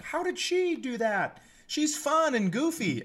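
high, slow, baffled; drawn out in mock disbelief. Whispering classmates baffled she is smart, mock disbelief. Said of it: "People are like".

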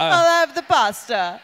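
slow mock Italian accent ordering pasta. Diner orders pasta from Italian waiter, mock Italian accent. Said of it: "orders food in that restaurant's origins accent".

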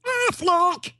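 high squawking duck call of the brand name. Retold or imitated. His audition take, squawked like a duck. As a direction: quack the name out like a cartoon duck. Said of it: "finally I do this one".

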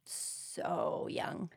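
very low, soft near-whisper; hushed dismay. Real, not acted. Co-host reacting to a hanging at twenty-two, hushed.

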